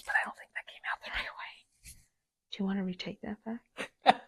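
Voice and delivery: hushed whisper aside. Real, not acted. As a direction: whisper it so the mic barely catches it. Joking aside to cohost.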